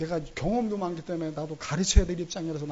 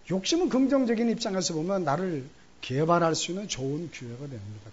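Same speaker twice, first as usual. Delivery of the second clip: ordinary talk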